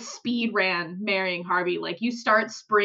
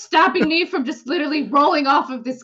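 hammed-up despair; 5 semitones higher, 5 dB louder than usual. Voicing despairing drunk character.

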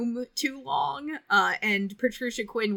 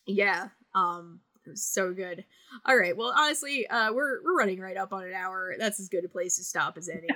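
ordinary talk, nothing marked

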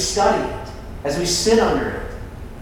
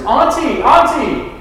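high, louder mock yell of a small boy; retold child's yell; 9 semitones higher, 5 dB louder, slower than usual. Three-year-old calls from bed to his auntie, imitated yell. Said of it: "he screams at the top of his lungs".

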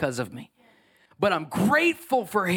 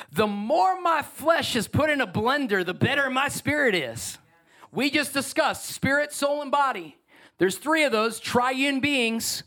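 higher, pushed preaching voice; fiery preaching; 7 semitones higher than usual. Acted. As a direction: preach it with full fire. Preaching rising to full force.

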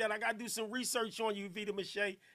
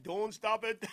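imitating Jamaicans; slower than usual. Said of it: "They be like".

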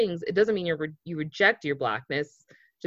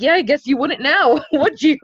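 higher, louder teasing reply with a laugh; laughing retort; 9 semitones higher, 7 dB louder than usual. Retold or imitated. He answers the client with a laughing tease. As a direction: tease her back, laughing.

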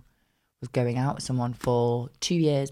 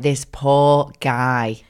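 slow pitying; 5 dB louder, much slower than usual. Retold or imitated. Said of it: "I was like".

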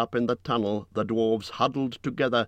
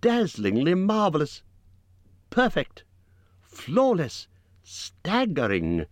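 much higher, over-the-top praise; gushing exclamations; 8 semitones higher than usual. A narrator's reading. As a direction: exclaim in false awe. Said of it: "exclaimed Bilbo aloud".

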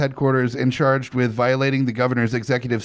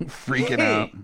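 normal talking voice

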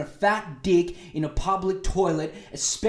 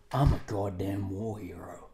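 much lower, softer, stone-cold menace; Alan Jones impression; 7 semitones lower, 4 dB quieter than usual. Alan Jones threatening Ben Fordham, much lower and stone-cold. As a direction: stare him down and growl it low. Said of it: "look at you with those stone cold eyes and go".

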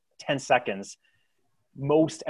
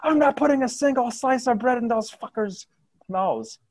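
high, loud angry shout; shouting civilian; 14 semitones higher, 7 dB louder than usual. Acted. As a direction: bark it at the crowd. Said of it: "another one shouts".

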